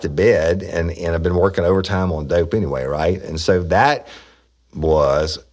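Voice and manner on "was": usual speaking voice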